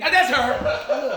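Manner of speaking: slow, high, laughing drawl; laughing recognition. Retold or imitated. Realizing his sister sold it, laughing. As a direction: stretch it out laughing.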